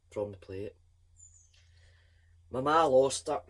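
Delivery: usual speaking voice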